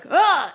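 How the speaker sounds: loud, high mock "ugh"; exasperated groan. Angry kid answering a parent, exasperated groan. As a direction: burst out with an imitated groan of disgust. Imitates child's exasperated outburst.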